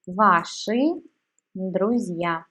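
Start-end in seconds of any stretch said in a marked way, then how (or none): none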